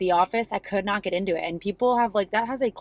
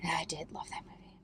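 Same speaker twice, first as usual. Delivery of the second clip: whispered, much softer; 2 semitones lower, 13 dB quieter, faster than usual. Real, not acted. Quietly admitting she loved the movie, whispered.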